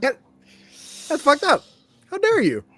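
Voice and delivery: high, loud indignant protest; mock outrage. Player scolding the dice or game master, loud mock outrage.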